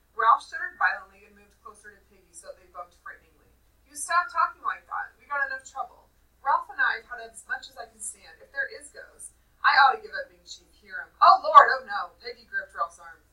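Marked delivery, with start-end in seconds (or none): 3.9-12.1 put-on raised, louder voice of a rattled boy; mildly acted alarm, pushed louder; alarmed protest. A narrator's reading. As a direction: protest with mounting dread. Frightened boys argue in the story.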